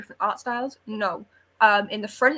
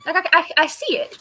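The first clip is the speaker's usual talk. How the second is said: stammering it out through a laugh, louder; half laughing as she admits it; 7 semitones higher, 6 dB louder than usual. Real, not acted. Laughing at the shared reaction.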